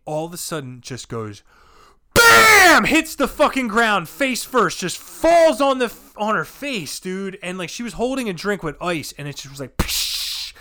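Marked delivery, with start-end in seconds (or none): 2.1-2.8 shouted, high "bam" impact; loud crash noise. Retold or imitated. Imitates a fall's crash. 9.8-10.5 hissed splash of spilling ice; voiceless burst, like a dropped cooler; imitated crash. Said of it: "it sounded like a cooler".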